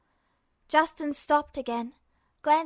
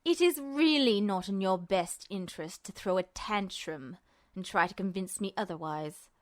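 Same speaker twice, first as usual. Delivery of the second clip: low, calm and condescending; cold villain; 5 semitones lower than usual. Acted. Justin coolly warns Ed against a tantrum. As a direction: lecture him coolly.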